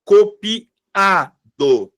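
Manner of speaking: "Copiado" very slowly in pieces, co-pi, A, do, with the A set apart; "Copiado" stretched out to show the strong A; stressed A. Shown as a demonstration. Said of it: "a sílaba tônica tá aqui".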